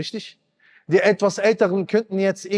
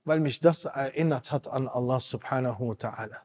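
ordinary talk

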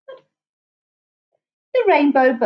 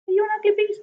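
high question to beast; 11 semitones higher, much faster than usual. A narrator's reading. Asking the creature, pitch jump.

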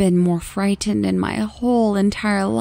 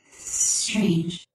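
a soft, eerie whisper from a creature; 2 semitones lower, 6 dB quieter than usual. A narrator's reading. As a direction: breathe the word like something inhuman. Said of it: "whispered softly".

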